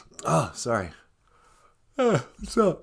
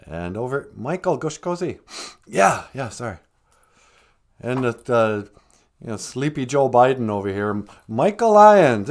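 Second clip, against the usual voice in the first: ordinary delivery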